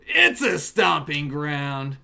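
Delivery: loud and slow, savoring the pull; excited shout. Real, not acted. Streamer yells at his chat over the pull, excited shout. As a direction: belt it out over a great card.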